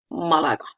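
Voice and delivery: Malaga with strong stress on opening MA, falling away; stress on first syllable. Shown as a demonstration. Teacher showing an irregular word, stress on MA of Malaga. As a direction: hit the first A of Malaga hard. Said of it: "The stress is in the first A".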